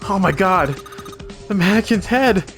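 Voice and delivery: shocked. Real, not acted. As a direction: blurt it out in shock. Shocked by mannequin's head.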